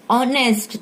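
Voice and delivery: "ऑनेस्ट" starts on the vowel O, with no H sound; silent h pronunciation. Shown as a demonstration. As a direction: say "ऑनेस्ट" as "onest", with the H dropped. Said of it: "हम इसको pronounce करते हैं वी से ऑनेस्ट". ("ऑनेस्ट" बिना एच की आवाज़ के, ओ से शुरू)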